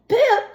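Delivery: squeaky single pip; high chick peep. Acted. Daisy copying her brother, high squeaky pip. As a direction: peep back at the baby brother. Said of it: "said Daisy".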